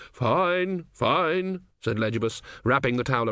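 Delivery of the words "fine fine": put-on old man voice. A narrator's reading. Legibus waving it off.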